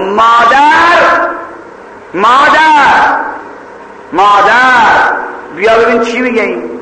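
high, long-drawn calls; shouting for mother. Acted. Girl calls her mother about the intruder, drawn-out shout. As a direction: call out for mother, stretching the word. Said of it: "یه وقت دختره داد زد".

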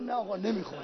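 very soft, falling murmur; hushed refusal. Acted. Thirsty horse refusing water before its master, hushed refusal. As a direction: refuse almost under the breath.

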